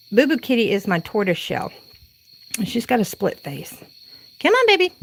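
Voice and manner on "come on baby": much higher sing-song pet call; calling the cats. Real, not acted. Calling her cats into the house at night, high sing-song. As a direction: coax the kitties in with a high, louder call.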